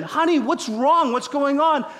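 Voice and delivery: lightly voiced husband, pitched high; concerned husband. Voiced husband asks his crying wife.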